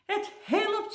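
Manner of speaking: high-pitched cry of astonishment; astonished squeal; amazed exclamation. A narrator's reading. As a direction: marvel aloud that it worked. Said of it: "zei hij hardop, vol verbazing".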